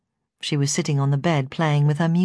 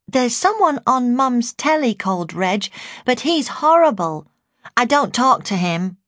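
put-on higher-pitched little girl's voice; child's voice; 7 semitones higher, 3 dB louder than usual. A narrator's reading. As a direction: answer as a young child, pitched up. Narrator voices the child answering.

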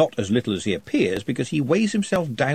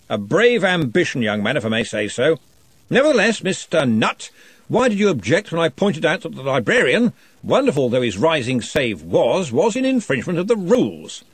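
booming wizard questioning; 5 semitones higher than usual. Acted. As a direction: press the shy goblin with jovial authority. Ridcully questions Nutt.

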